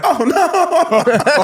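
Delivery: high, loud comic wail of dismay; mock panic. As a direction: wail it in hammed-up horror, half laughing. Retold dismay at botched audition.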